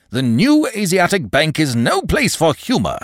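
high, loud, insistent scolding; indignant reprimand. Acted. Manager warns clerk against joking, indignant. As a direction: hammer it out as an irate boss.

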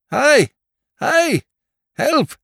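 very high, loud separate cries; shout for help. A narrator's reading. Two men trapped in a bog, shouting for help. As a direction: yell out for rescue.

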